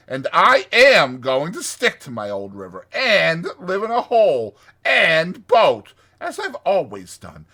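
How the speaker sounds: loud, emphatic, stubborn insistence; dogged. A narrator's reading. Rat standing by his old river, dogged. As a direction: hammer out each point defiantly. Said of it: "said the rat doggedly".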